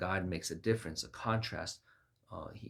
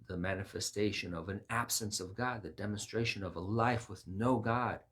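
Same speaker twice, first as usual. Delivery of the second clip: ordinary talk, nothing marked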